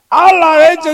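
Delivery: high yelp through a laugh; laughing high mock curse; laughing exclamation. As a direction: blurt it out while laughing.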